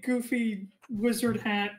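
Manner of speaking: usual speaking voice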